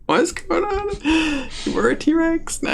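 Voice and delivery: high, slow puzzled creature voice; a baffled beast asking questions; confused dinosaur voice. Acted.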